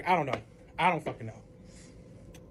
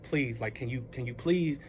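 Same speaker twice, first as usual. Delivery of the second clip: low, slow retold plea; understated imploring; subdued begging; 6 semitones lower, 3 dB quieter, slower than usual. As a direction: plead quietly, as if worn down. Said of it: "I'm begging".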